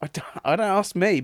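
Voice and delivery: usual speaking voice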